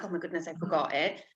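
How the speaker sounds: normal talking voice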